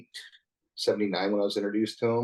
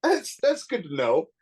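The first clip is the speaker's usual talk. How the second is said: laughing retort; 13 semitones higher, 3 dB louder, slower than usual. Retold or imitated. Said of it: "It's like".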